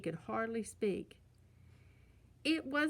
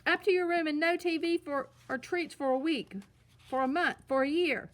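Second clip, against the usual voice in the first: higher, loud building punishment yell; furious roar; 6 semitones higher, 6 dB louder than usual. A narrator's reading. Papa punishing the cubs, escalating shout. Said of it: "roared Papa".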